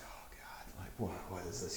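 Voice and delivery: whispered, fed-up aside; weary whisper. Acted. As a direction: breathe it out under your breath in dismay. Kaelin voiced by GM, aside.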